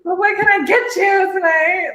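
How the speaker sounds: high, bright fake-friendly server voice; cheery waitress greeting, laid on thick; customer service voice. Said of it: "customer service voice".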